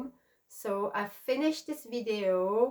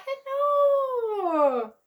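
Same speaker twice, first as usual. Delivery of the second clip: bright, much higher hello to a friend; excited hello; 13 semitones higher, 4 dB louder than usual. Real, not acted. Greeting Pamela, who might be watching, with a high excited hello. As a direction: call out hello with delight.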